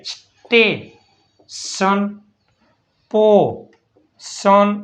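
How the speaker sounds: drawn-out syllable by syllable; slow sounded-out word. Shown as a demonstration. Teacher blending letters into a word slowly. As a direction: let the word stretch so kids hear each sound. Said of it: "એસ ટી એ".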